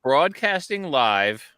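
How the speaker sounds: slow, booming radio announcer. Pretend. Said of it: "the dulcet tones of my radio voice".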